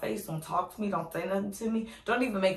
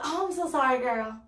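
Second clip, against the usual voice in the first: mock apology; 6 semitones higher, 3 dB louder than usual. Mocking stylist's fake apology.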